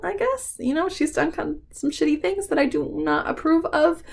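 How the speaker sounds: high, tearful voice; crying. Real, not acted. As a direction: talk through tears about the author. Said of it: "watching me cry".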